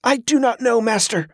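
high, strained and out of breath; a struggling boy gasps out the answer; panting boy. A narrator's reading. Boy panting while sparring.

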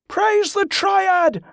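high, slow chanted response; crowd shout. A narrator's reading. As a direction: boom it back as a crowd. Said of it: "the crowd shouted back".

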